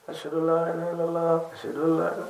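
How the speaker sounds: low, hushed call to prayer; imitating a hesitant, subdued muezzin; said quietly. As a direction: recite the testimony softly, under the voice. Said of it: "آہستہ کہنے لگے".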